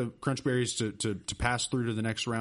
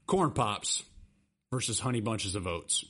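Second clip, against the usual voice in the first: usual speaking voice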